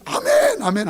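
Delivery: a shouted, high amen; loud amen. Retold or imitated. Churchgoers praying in a restaurant, a loud amen. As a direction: bellow the amen far too loud. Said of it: "아멘 소리가 너무 커서".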